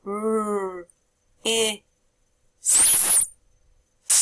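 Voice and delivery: "R, I, S, T" as four separate sounds; sounding out words. Shown as a demonstration. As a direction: segment "R, I, S, T" sound by sound with pauses. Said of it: "Listen carefully".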